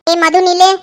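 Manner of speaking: loud, sharp command to stop her; angry shout. Acted. Mother shouting at her daughter to stand still. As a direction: bark her name to halt her in her tracks.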